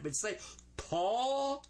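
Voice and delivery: "tall" very slow and high, the L left off; say tall without the L. Shown as a demonstration. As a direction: stretch "tall" out for the class to copy. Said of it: "Don't say the L on it".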